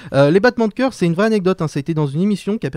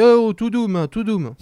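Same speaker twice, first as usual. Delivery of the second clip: soothing mock voice; 6 semitones higher, 5 dB louder, slower than usual. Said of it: "qui lui disaient".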